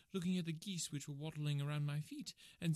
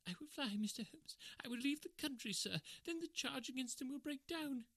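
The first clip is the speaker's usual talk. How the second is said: much higher, pleading thief's voice; desperate begging; 7 semitones higher than usual. A narrator's reading. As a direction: beg for your life, near tears.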